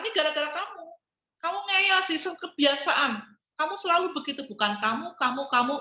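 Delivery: high, accusing mock rant at the partner; blaming outburst. Shown as a demonstration. Wife demonstrating the wrong way to scold her husband, high accusing rant. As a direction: point the finger, voice climbing. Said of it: "jangan kemudian menyalahkan".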